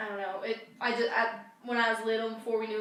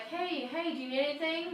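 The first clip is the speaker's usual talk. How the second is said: put-on higher-pitched, gentle voice for a toddler; gentle concern; 6 semitones higher than usual. Older sister asking her possibly scared little brother, gently.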